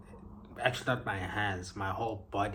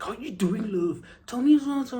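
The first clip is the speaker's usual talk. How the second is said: much higher, louder mock Liverpool accent; Scouse imitation; 13 semitones higher, 7 dB louder than usual. Imitating a Liverpool local greeting her. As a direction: put on a light Scouse sing-song. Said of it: "I can't really, uh, imitate them".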